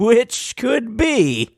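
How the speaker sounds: high, drawn-out, playful tease; mock-mysterious high lilt; teasing hint. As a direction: stretch it out high, hinting at a spoiler.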